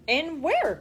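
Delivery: cried out. A narrator's reading. Charlie pressing Grandpa Joe, crying out. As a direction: blurt it out, curious. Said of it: "cried Charlie".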